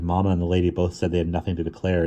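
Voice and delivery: plain reading voice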